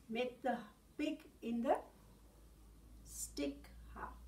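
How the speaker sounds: usual speaking voice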